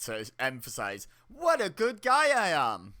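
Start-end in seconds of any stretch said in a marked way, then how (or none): 1.3-2.9 higher, slow, fake nice guy; smug mock sincerity; insincere lifted voice. Shown as a demonstration. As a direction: lift the voice to sound insincere. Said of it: "a little bit of insincerity by lifting your voice".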